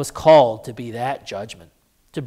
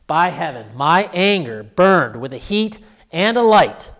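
raised, impassioned oath; burning righteous anger; 4 semitones higher, 6 dB louder than usual. Acted. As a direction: swell into loud, high indignation. Recalls his burning righteous anger.

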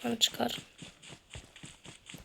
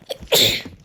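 sneeze; 5 dB louder than usual. Real, not acted. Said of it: "Hapşurdum".

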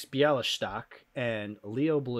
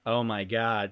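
ordinary delivery

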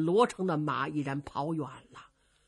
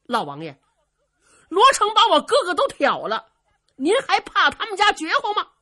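angry outburst at an elder; growing anger; 10 semitones higher, 10 dB louder than usual. A narrator's reading. Dongfang Bo confronting the old lord over his brother, growing anger. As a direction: build to a loud, high-pitched protest. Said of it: "东方伯是越听越有气呀".

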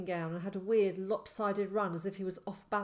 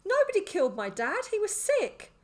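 high, loud protest; shouting; 13 semitones higher, 8 dB louder than usual. A narrator's reading. Boy defends his dad's death to madman, shouting. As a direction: shout the denial, climbing higher. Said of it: "I shouted again".

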